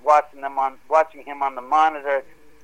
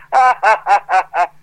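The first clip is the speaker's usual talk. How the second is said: loud mocking cackle, higher than usual; imitated cracking up; 4 semitones higher, 8 dB louder than usual. Crew laughing at a teammate's meltdown, imitated loud guffaw. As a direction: mimic someone laughing hard at another's meltdown. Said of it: "they're cracking up".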